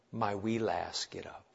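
low, very soft coaxing; hushed and motherly, dropping away; tender wake-up call. Acted. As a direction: gently rouse a sleeping little one. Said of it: "a mom waking up a little one".

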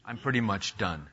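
ordinary talk

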